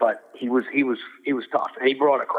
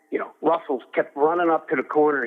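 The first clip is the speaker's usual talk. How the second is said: normal talking voice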